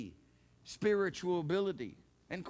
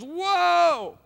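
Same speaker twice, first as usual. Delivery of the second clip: loud, high cry of wonder; amazed whoa; 10 semitones higher, 12 dB louder than usual. Retold or imitated. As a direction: gasp out a big astonished whoa. Crowd marvelling at heavenly realm.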